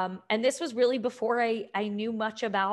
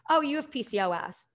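breezy, higher, like mentioning the weather; offhand, casual; 5 semitones higher than usual. Retold or imitated. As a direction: toss off the diagnosis as if it were nothing. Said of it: "it was like, "Oh, it's raining out".

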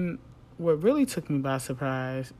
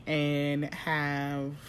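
ordinary talk, nothing marked

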